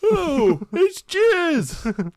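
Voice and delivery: high, loud realization; excited. Acted. Acting discovery loudly.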